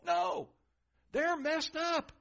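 high, loud, slow punch; preacher's loud, high-pitched exclamation; emphatic no. Acted. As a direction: snap the denial out high and hard.

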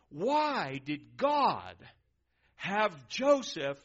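slow, loud, every word weighed; preacher stretching the words for weight; drawn-out emphasis. Acted. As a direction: lean hard on the question. Rhetorical question, drawn out.

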